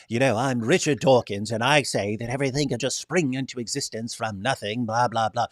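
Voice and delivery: lightly mimicked smart British accent; a hint of learned English accent; Richard Dawkins. Host mocking intelligent-sounding atheists, light smart British accent. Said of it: "the accent of, a".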